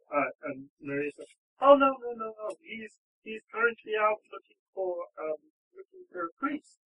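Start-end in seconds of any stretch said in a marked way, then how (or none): 1.6-6.8 high, fretful woman's part; raised pitch, hurried reassurance; flustered captive bride. Acted. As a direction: blurt it out, flustered.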